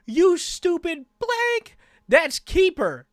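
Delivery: slow, high, loud exasperated scolding; mock angry yell. [name] scolds teammate for catching Keeper, mock furious. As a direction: yell it in mock outrage, stretching the words.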